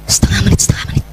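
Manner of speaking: hushed self-reminder; whispered. Shown as a demonstration. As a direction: whisper half a minute to yourself. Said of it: "whisper reminder".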